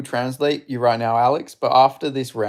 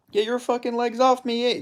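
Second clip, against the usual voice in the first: higher-pitched hammed-up Canadian accent; Albertan hoser; 10 semitones higher than usual. Gamer voicing his fighter's taunts in a high Canadian accent. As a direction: play the fighter as a trash-talking Canadian hoser. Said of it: "I'm doing that voice for this guy".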